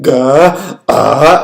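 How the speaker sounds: very loud mock bawling; imitated embarrassing loud cry; loud crying imitation. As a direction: wail out an exaggerated sob, high and loud. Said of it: "loud crying where I was just like".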